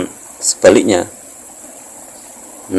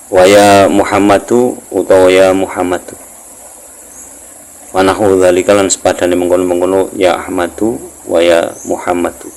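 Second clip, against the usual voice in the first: kitab recitation; 4 dB louder than usual. Acted. Teacher reading the next prohibition from the text, projected kitab recitation. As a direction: recite the Arabic and Javanese gloss with stretched phrase starts.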